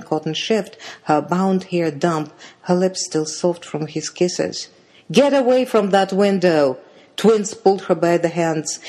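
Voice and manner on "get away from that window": higher, louder sharp command; urgent scolding. A narrator's reading. Twins find shivering sister at the window, urgent scolding. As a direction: order her away urgently.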